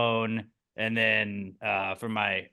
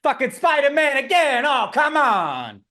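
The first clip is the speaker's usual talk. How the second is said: higher, louder exasperated wiseguy; mock Italian complaint; 9 semitones higher, 5 dB louder than usual. Italian neighbor fed up with Spider-Man, hammed-up exasperation.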